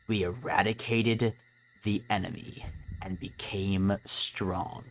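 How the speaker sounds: low, slow and menacing; ominous Chara. Acted. Chara tells the player why they returned. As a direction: draw each phrase out like a cold threat.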